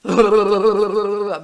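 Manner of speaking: loud, juddering shiver noise; shivering in shock. Retold or imitated. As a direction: shudder out a long imitated shiver. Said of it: "I started shaking".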